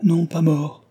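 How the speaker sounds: high, loud, urgent denial; a sudden loud outburst of relief; eager cry. A narrator's reading. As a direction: cry it out to correct her at once. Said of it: "s'écria-t-il".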